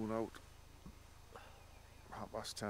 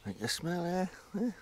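higher, playful pet-talk voice; talking to the dog; 10 semitones higher, 4 dB louder than usual. Real, not acted. Teasing the dog about the chicken smell, high pet-talk. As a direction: tease the dog with a lifted sing-song.

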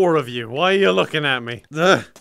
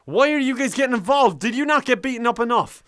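ordinary talk

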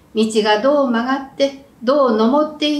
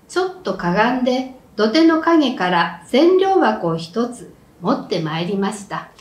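ordinary delivery, nothing marked

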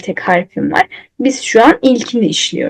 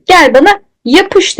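voicing the letter; 8 semitones higher, 4 dB louder than usual. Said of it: "demiş".

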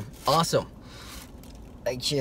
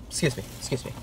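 whisper; 5 semitones lower, 8 dB quieter, much faster than usual. Real, not acted. Playfully whispering to people nearby.

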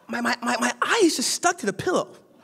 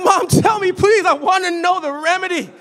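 higher, louder begging; pleading; 9 semitones higher, 6 dB louder than usual. Retold or imitated. Son begging mom for the remedy, loud eager plea. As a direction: beg your mom eagerly. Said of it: "I was like".

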